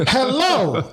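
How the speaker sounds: put-on high, strained hello from a confused old lady; aunt who can't hear the phone calls out; old aunt's hello. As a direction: squeak it like a deaf old auntie. Hard-of-hearing aunt on phone.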